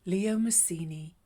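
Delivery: ordinary delivery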